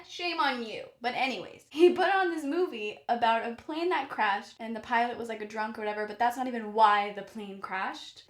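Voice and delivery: usual speaking voice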